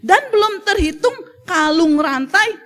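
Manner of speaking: loud, rising sermon voice with a laugh in it; preacher marvelling at the gold; fervent preaching. Acted. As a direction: build the list of riches with excitement.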